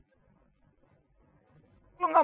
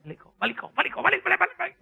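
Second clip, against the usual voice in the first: mock dismissal; 9 semitones higher, faster than usual. Heaven sending people back.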